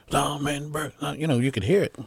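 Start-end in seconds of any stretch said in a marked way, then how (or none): 0.1-0.9 hollering crowd. Retold or imitated. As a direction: imitate a crowd calling out a name. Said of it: "people are hollering".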